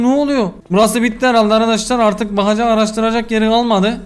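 normal talking voice

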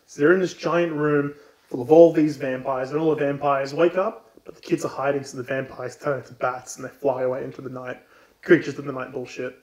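ordinary talk

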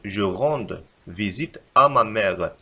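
slow, careful, with weight on à; slow corrected sentence. Shown as a demonstration. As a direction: model the correction word by word, leaning on à. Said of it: "بل نكتب". (جملة مصححة ببطء مع تشديد على à)

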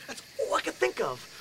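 usual speaking voice

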